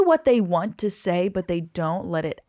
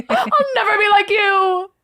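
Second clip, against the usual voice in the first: much higher and louder, squealed through a laugh; laughing mock-heroic vow; 11 semitones higher, 8 dB louder, slower than usual. Friend replays the hero's defiant vow, laughing and squealing. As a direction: overplay the hero's vow until it cracks into laughter.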